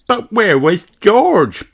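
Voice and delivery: put-on high, slow child question; asking. A narrator's reading. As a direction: ask like a puzzled small girl. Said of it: "Peppa asked".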